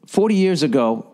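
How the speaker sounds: ordinary talk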